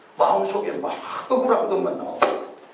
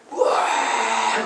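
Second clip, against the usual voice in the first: amazed cry; 5 semitones higher, much slower than usual. Retold or imitated. Describing roaring forge fire.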